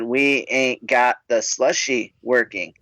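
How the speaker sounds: imitated clerk spelling it out word by word; slow, spaced-out words for someone not getting it; slow, deliberate repeat. Clerk to customer ordering Baja Blast, slow and deliberate.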